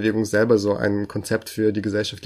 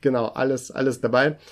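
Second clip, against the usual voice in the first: higher, cheery sales pitch; chipper 1950s ad; 4 semitones higher, 3 dB louder than usual. Pretend. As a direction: sell it like a vintage commercial. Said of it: "Kaufen Sie jetzt!".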